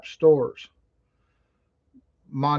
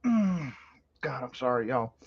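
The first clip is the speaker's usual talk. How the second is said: in pain; 6 dB quieter than usual. Real, not acted. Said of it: "It even hurts to breathe".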